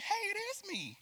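high, bright-toned cheery answer; mock cheery reply. Greeting a friend who called her [name], mock cheery. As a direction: play the happy friend answering. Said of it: "you're supposed to be saying".

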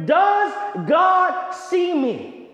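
pleading question. Retold or imitated. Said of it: "you're wondering".

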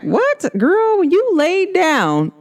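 high, slow incredulous retort; mock indignation. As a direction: sass your grandma back. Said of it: "I'm like".

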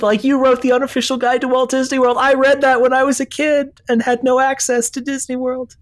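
high, excited fangirl gush; gushing fan. Retold or imitated. Fan geeking out to Len Testa, excited and high. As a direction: gush like a starstruck fan meeting a hero. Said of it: "I geeked out about it".

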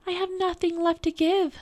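put-on high, light girl's voice. A narrator's reading. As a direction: voice the girl, pitched well up. Girl answers the little man.

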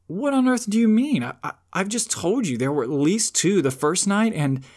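put-on a frightened boy blurting out his protest; startled indignant outburst; shocked boy. Shasta shocked, objects.

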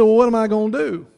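high, loud, dismayed question; dismayed neighbor. Retold or imitated. Neighbor asking what to do with the feet, high and loud. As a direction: throw up your hands in mock despair. Bird voiced, distressed.

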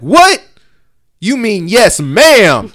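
high, loud mock stern correction; uncle correcting. Uncle correcting the boy, high, loud and stern. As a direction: scold the boy's manners. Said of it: "he'd be like".

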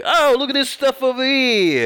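put-on high, loud, slow New York accent. Joking about the next podcast, loud New York accent. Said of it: "will just be me doing that".

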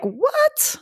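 sudden high, amazed yelp; stunned "what". Retold or imitated. Told she is pregnant, a high astonished "what". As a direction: shoot up in disbelief at the news.

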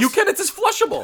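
high, loud insistence; indignant shout. Pretend. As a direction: insist loudly in outrage. Mock heated argument over wipes.

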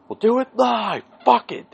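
high, loud mock tantrum; hammed-up on-air meltdown; Bill O'Reilly outburst. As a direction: yell it like a fed-up anchorman losing it. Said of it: "pulled the Bill O'Reilly thing".

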